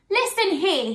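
high, indignant call; Herbie. A narrator's reading. Herbie turns to the laughing animals, mild shout. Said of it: "he shouted".

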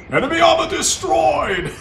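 loud, high victory shout; triumphant announcement. Pretend. Commentator announces enemy destroyed, triumphant shout. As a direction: bellow the kill like an announcer.

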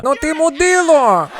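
high, drawn-out screech of abuse; mock furious girlfriend. Girlfriend phones comic after the prank, mock screaming. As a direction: screech it like an outraged woman.

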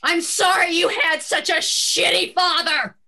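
loud call from down the street; shouted apology. Acted. Tiffany turns back and yells to Michelle down the street, loud. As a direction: yell it back over the distance.

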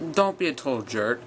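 normal talking voice

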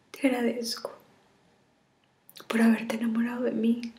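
tearful, half-whispered farewell; tragic heroine sorrow. Acted. Farewell video to lover.

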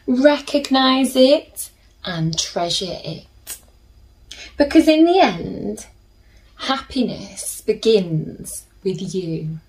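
gentle storybook reading with slow pauses; soothing storybook narration for little listeners; reading to children. A narrator's reading. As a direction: read it like a bedtime picture book. Reads picture book to children.

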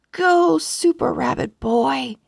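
plain reading voice

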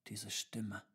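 hushed, secretive half-whisper. A narrator's reading. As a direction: whisper it softly, listening out. Tutor hears a mysterious voice. (leise geflüstert, lauschend)

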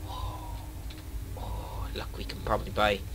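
soft excited whisper. Real, not acted. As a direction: lean in and whisper. Whispering at shop screen.

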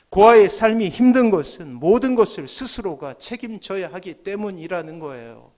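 ordinary delivery

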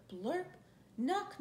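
ordinary delivery

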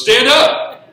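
full-force high shout; exclaimed command. Shown as a demonstration. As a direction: bellow it as a sudden, startling order. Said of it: "That's not what he says. He goes".

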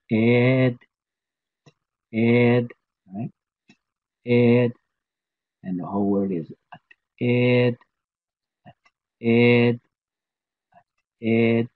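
"ed" clipped high with a glottal stop; short glottal T. Shown as a demonstration. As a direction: snap out "ed" with a glottal catch. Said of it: "here we're using the short sound".

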